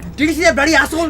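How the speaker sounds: high, incredulous rant; outraged. Acted. Driver raging to passenger about another car, outraged. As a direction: demand that she share your outrage.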